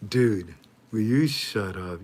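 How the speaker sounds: ordinary talk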